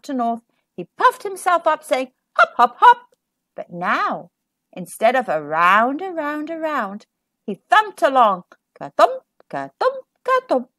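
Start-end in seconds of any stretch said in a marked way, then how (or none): 2.4-3.1 high and louder, bouncing; high bouncy hop. Acted. Snake says hop hop hop. 8.8-10.7 high, bouncy thumping chant; rhythmic thump sounds. A narrator's reading.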